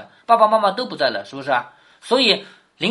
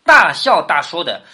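大笑大说 slow and louder, 大 hit hard; punch 大笑大说; 6 dB louder, slower than usual. Shown as a demonstration. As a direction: stamp out 大笑大说 word by word. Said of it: "四个字，直接把史湘云的性格说出来了". (放慢加重，大声强调“大笑大说”)

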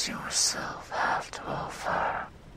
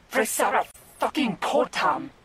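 frustrated incantation; 7 semitones higher, 5 dB louder, faster than usual. Acted. Spell fails, casts it again.

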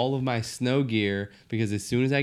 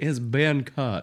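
slow mock Southern drawl; 3 semitones higher, 3 dB louder, much slower than usual.

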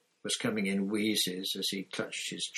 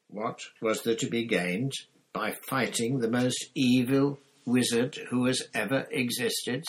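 louder, scornful counter-question; furious retort; 4 semitones higher, 5 dB louder than usual. A narrator's reading. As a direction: throw the question back in anger. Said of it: "with a terrible fury".

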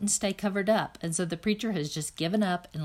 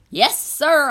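loud, higher yell in reply; yelled back; 6 semitones higher, 8 dB louder than usual. A narrator's reading. Opal yelling back at the preacher. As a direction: shout it right back. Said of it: "I yelled back".

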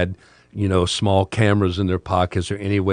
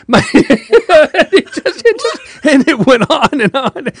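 laughing; 8 dB louder than usual. Real, not acted. Laughing at his own story.

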